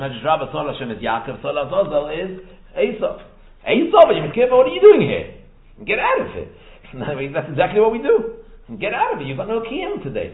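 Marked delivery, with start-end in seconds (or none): 3.6-6.5 loud, high-pitched mock telling-off; acted indignant challenge. Speaker confronting Esav on Yom Kippur, acted indignant challenge. 8.8-10.3 acted dismissal. Acts out dismissing Esav.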